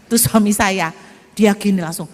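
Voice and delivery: usual speaking voice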